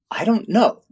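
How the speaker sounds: high-pitched retold cluelessness; baffled translator. Translator answering the visitor's question, baffled.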